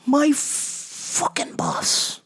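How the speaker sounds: hushed, slow whisper; whispered aside. Real, not acted. Speaker muttering about the boss, whispered. Aside while retelling snitching.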